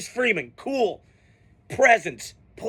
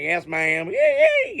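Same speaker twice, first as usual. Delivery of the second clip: mock Southern drawl, yee leaping way up; fake Southern accent; 4 semitones higher, slower than usual. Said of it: "trying to fake that Southern accent".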